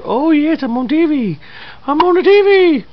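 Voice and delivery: high, bright mock baby voice. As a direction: squeal as the baby on camera. Voicing baby about being on TV.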